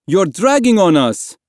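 loud, high yelled protest; yelling. A narrator's reading. Woman in the dinghy to the boy clinging on, yelling. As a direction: yell down in alarm. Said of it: "a woman in the dinghy yelled".

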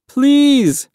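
high, loud cry of pleading; desperate begging. A narrator's reading. Boy in the sea begs to be let aboard, desperate high plea. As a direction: plead for your life. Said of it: "Mahmoud begged".

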